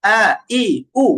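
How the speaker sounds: hard throat onset on "আ, ই, উ"; firm hamza. Shown as a demonstration. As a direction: punch out "আ, ই, উ" with the airflow shut. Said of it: "বাতাস বন্ধ থাকছে".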